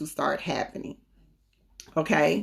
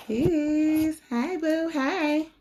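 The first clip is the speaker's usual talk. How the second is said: high sing-song hello to arriving viewers; bright greeting; 11 semitones higher, much slower than usual. Real, not acted. Reader greeting viewers as they join, high and sing-song. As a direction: lift the voice to welcome a friend.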